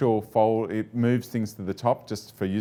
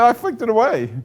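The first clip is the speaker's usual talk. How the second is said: high, raised-voice insistence; exasperated retold reply; 9 semitones higher, 5 dB louder than usual. Driver reassuring his frightened colleague about the spider, mock exasperation.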